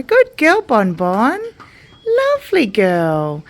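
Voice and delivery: bright sing-song praise for a cow; cooing. Real, not acted. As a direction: gush over her like a pet.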